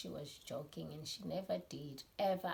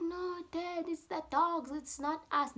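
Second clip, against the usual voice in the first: high, loud protesting; imitated denial; 13 semitones higher, 7 dB louder than usual. Boys blaming the dogs to their dad, denying. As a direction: plead innocence in a raised boy's voice. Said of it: "Now they're denying".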